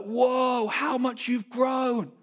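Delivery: high, drawn-out mock astonishment; amazed grandparents. Grandparents greeting grown grandkids, amazed. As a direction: exclaim it high and slow, play-acting delight. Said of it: "They'll be like".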